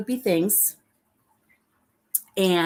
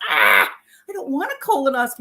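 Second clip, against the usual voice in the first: whiny protest; 5 semitones higher, 4 dB louder, faster than usual. Retold or imitated. Retold whining protest.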